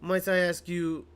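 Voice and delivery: polite request in a hammed French accent. Gamer reading a caller's message for Miss May, French accent. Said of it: "I get to do a French accent".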